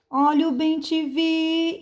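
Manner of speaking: high hawker's cry, VI sustained; street vendor's call. Shown as a demonstration. Teacher demonstrating the selling trick on bem-te-vi, vendor's call. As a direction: sell bem te vi with a long, level ending. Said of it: "é você vender a palavra".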